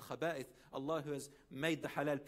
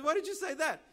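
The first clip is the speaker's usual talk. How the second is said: high, loud, startled asking; shocked question; 12 semitones higher, 8 dB louder than usual. Retold or imitated. The preacher asks the father why he cursed himself, loudly and in shock. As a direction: demand an explanation in disbelief.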